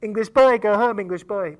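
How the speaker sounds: shouted jeer, loud and high; mocking shout. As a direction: yell English boy, go home like a village taunt. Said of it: "They say".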